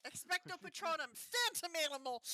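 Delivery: loud, high incantation; spell casting. Pretend. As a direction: shout it like a wizard casting.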